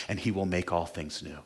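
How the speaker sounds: ordinary talk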